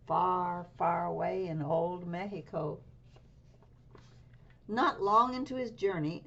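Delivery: ordinary delivery, nothing marked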